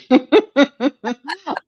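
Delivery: high, loud run of giggles; amused laughter. Real, not acted. Reader laughs at own calculating-sounding advice, high giggle. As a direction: burst into high chuckling.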